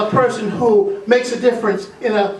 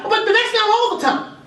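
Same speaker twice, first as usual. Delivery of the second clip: much higher, a sheepish dog; 12 semitones higher than usual. Acted. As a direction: pipe it up defensively. Clean dog voiced high.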